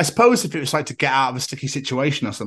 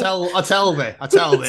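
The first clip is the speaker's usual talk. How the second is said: broad Yorkshire dialect; Yorkshire accent; 4 semitones higher, 4 dB louder than usual. Acted. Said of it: "It's all the northern stuff".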